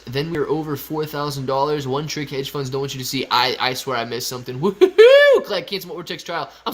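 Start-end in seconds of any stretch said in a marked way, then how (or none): 4.6-5.4 loud, high-pitched woohoo cheer; excited whoop. Real, not acted. Excited cheer during live stream.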